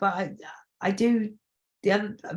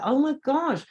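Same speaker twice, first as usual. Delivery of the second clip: excited surprise; 7 semitones higher than usual. Retold or imitated. Realizing her song suits a wedding, excited and high. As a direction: gush with delight. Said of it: "And I was like".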